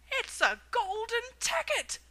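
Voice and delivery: high, loud, slow excited scream; shopkeeper screaming. A narrator's reading. Shopkeeper screaming at the golden ticket, high and loud. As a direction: shriek it in disbelief. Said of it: "screamed the shopkeeper".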